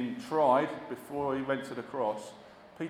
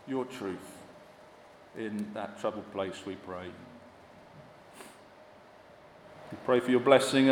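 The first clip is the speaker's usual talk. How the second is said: hushed pastoral prayer voice; 4 semitones lower than usual. Acted. Minister praying over the congregation, hushed and earnest.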